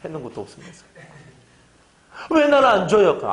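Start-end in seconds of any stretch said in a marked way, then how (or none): 2.3-3.2 higher, louder whining demand; whiny complaint. Retold or imitated. Believer whining at God, higher and louder. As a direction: nag like a spoiled believer.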